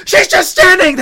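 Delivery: high, loud mock screaming at the screen; outraged yell. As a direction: holler it in drawn-out disbelief. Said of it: "throwing cookies at the T V screen saying".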